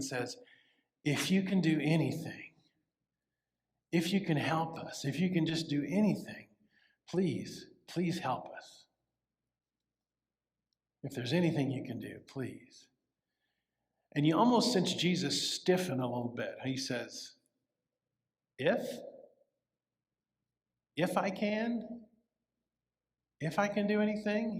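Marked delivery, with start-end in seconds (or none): 1.0-12.9 quiet pleading. Acted. Father begging Jesus for help. 18.6-24.6 incredulous echo. Acted. Jesus stiffens, questions doubt.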